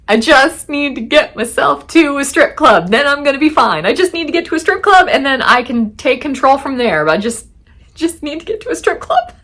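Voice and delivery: frantic inner thoughts. Retold or imitated. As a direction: talk yourself into it with manic urgency. Panicked inner monologue, quoted thought.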